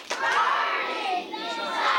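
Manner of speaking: high, hugely stretched rallying cry; with feeling. Shown as a demonstration. Leader shows young audience step one with feeling, high drawn-out cry. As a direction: belt it out slowly for the crowd to join. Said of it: "But with some feeling".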